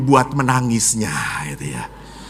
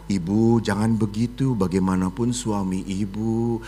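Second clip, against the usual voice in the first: gentle correction; 8 semitones lower, 10 dB quieter than usual. Retold or imitated. Good wife gently corrects.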